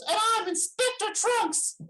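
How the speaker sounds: cartoonish puppet cheerfully introducing itself; squeaky puppet voice. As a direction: play the little puppet in a tiny high voice. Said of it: "the little puppet goes".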